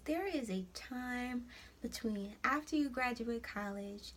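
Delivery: ordinary delivery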